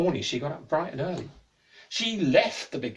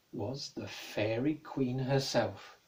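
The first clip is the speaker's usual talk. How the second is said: hushed reveal; 4 semitones lower, 4 dB quieter, much slower than usual. Acted. Dramatic reveal of captor.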